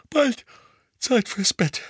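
high, drowsy yawn through the words. A narrator's reading. Mother yawning to everyone at the kitchen table. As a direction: yawn it out, ready for bed. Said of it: "sagte Misses Weasley gähnend".

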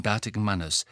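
ordinary delivery, nothing marked

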